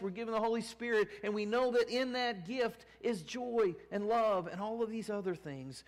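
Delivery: high, loud preaching push on the Spirit's joy; preaching at full force. Acted. Pastor preaching joy to the congregation, full force. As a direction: lift the congregation, full pulpit voice.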